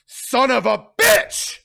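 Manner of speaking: Sonny cursing Carlo, loud; furious curse. Acted. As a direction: explode with rage. Sonny explodes.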